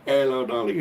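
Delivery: cheery greeting. Retold or imitated. Shopkeeper greeting in front of a queue, cheery. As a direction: greet them brightly. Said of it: "he's like".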